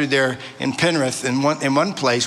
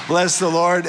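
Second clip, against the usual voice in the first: ordinary talk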